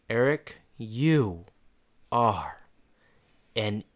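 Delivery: slow, one word at a time; deliberate scolding. Retold or imitated. Friend watching Eric lose the girl, slow deliberate scolding. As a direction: spell out the mock insult with long pauses.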